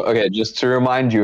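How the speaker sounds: ordinary talk, nothing marked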